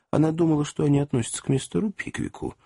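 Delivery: ordinary delivery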